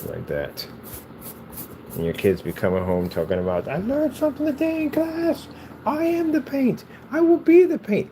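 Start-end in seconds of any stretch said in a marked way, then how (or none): 3.7-8.1 high, squeaky mock schoolchild; put-on high child voice; imitated kid. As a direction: pipe it up like a proud kid reporting home. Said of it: "kids will mimic all kinds of stuff".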